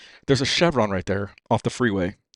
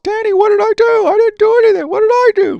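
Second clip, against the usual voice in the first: squeaky falsetto protest, much higher and louder; whiny mom voice; 10 dB louder than usual. Retold or imitated. Mom pleading innocence to angry husband, high squeak. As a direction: play the innocent wife in a shrill squeak. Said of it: "Like this, right?".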